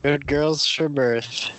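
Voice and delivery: slow, drawn-out mock title; slow deliberate title. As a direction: repeat it slowly. Insists on joke title.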